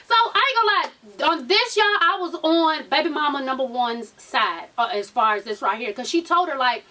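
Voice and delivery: usual speaking voice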